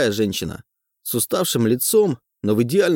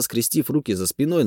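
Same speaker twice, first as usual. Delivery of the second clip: usual speaking voice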